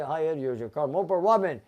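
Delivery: ordinary talk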